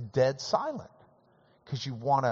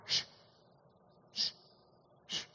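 shushing; 10 dB quieter than usual. Shown as a demonstration. Preacher demonstrating the short shushing sound. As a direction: shush the room gently. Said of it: "we abbreviate it now".

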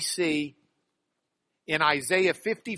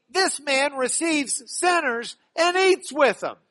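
grumbling Pharisee, higher and louder; mock the scandalised scribes, raised pitch; 9 semitones higher, 6 dB louder than usual. Preacher voicing the Pharisees' outraged complaint about Jesus, mock outrage.

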